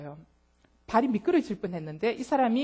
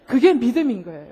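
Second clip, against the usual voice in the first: ordinary talk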